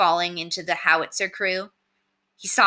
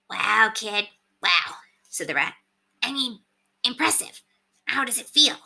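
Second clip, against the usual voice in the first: higher, smug little rat voice; Septimus the rat; 4 semitones higher than usual. A narrator's reading. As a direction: play a cocky talking rat on a shoulder.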